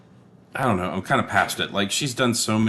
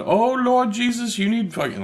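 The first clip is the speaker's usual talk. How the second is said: big exaggerated churchy wail; loud character impression; 4 dB louder than usual. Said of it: "tired of the super loud".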